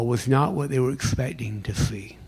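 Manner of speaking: hushed whispered question. Real, not acted. Pastor asking congregation, hushed whisper. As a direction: drop to a whisper.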